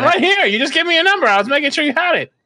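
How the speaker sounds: loud, high-pitched protest; insistent shout. Pretend. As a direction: shout that you are standing right here. Shouts that he is right there.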